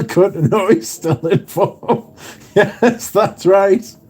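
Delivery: talking through a laugh. Real, not acted. To Kelly's chat joke, laughing along. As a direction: chuckle while agreeing.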